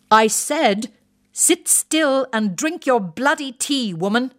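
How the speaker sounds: high, slow, barked order; retired officer bellowing at his wife from the balcony; bellowing wing commander. Acted. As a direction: bark it down at a cowering wife like an old officer.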